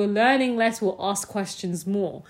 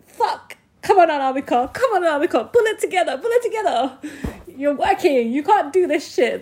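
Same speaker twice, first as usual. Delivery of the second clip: self-scolding; 8 semitones higher, 7 dB louder than usual. Retold or imitated. Scolding herself at work.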